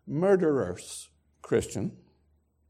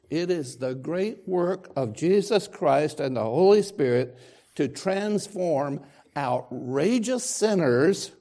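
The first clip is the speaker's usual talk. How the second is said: rising, louder preacher's push on sinners; forceful preaching; 4 semitones higher, 5 dB louder, slower than usual. Acted. Preacher to congregation, forceful sermon delivery.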